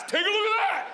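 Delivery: high-pitched showing-off shout; hammed-up yell. Daughter flashing her preschool class, hammed-up yell.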